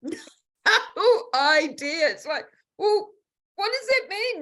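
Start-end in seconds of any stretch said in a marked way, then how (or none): 0.0-2.1 high, drawn-out words with a laugh; amused bafflement. Real, not acted. Recalls being totally baffled. 2.8-4.4 very high-pitched incredulous question; mock bafflement. Acts out her old bafflement.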